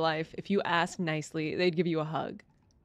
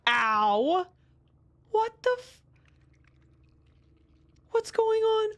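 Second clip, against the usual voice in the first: panicked high yelps; frantic cries for help while fleeing; being chased; 9 semitones higher, much slower than usual. Real, not acted. Chased by monsters, screaming.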